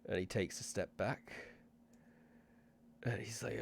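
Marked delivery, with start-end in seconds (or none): none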